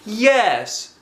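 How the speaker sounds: high, loud chorus yes; eager yes. A narrator's reading. The girls answering Mister Jack, high and eager. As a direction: answer brightly together. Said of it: "said all the girls".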